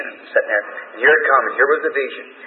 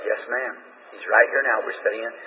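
softer, lower hushed reply; 4 semitones lower, 8 dB quieter than usual. Retold or imitated. Preacher quietly telling the woman the angel is here, hushed. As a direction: quietly reassure her. Reassuring her the angel is present.

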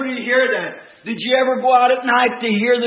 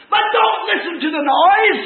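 high, raised pulpit voice; urgent preaching cry; 10 semitones higher, 4 dB louder than usual. Real, not acted. Preacher warning congregation off the noise, urgent cry. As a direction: warn them with rising fervor.

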